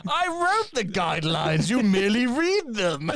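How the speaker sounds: hammed-up villainous boast; Bane. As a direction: declaim it like a grandiose villain. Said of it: "Has Bane got a job".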